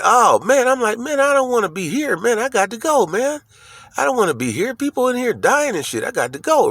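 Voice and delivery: retold panic, pitched well up; a high, anxious plea to get out; panicked. Among dying patients in the hospice, high panicky voice.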